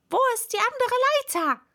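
high, fast, loud shout; hurried worker's yell; calling out. A narrator's reading. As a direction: shout across a busy crowd.

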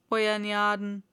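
rustic "Euer Gnaden" in dialect; low dialect servant; servant's voice. A narrator's reading. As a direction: address your master in broad dialect. Servant addresses master in dialect.

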